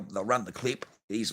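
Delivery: ordinary talk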